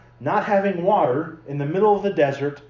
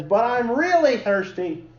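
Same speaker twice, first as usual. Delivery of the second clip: lightly voiced whining kid, pitched higher; poor child imitation; 7 semitones higher than usual. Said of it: "poor imitation of a child".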